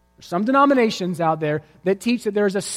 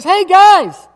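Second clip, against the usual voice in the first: high, loud call from far across; yelling across the canyon; 3 semitones higher, 8 dB louder than usual. Acted. Daredevil yelling back across the canyon to the crowd, high loud call. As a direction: shout back over the gap, triumphant. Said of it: "he yells back at us".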